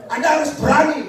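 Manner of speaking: bold urging. Real, not acted. Preacher urging boldness.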